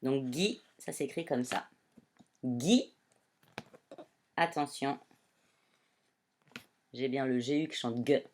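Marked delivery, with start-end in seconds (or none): none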